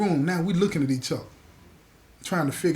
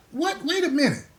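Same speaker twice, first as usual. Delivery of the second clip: high, sudden double-take; startled disbelief; 10 semitones higher than usual. Retold or imitated. Storyteller reacts to hearing about the found pack, startled. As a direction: cut in with acted shock. Acts reaction to the pack's discovery.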